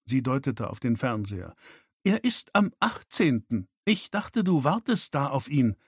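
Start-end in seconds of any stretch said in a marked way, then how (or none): 2.0-5.8 irritated. A narrator's reading. Daughter keeps snapping at her father.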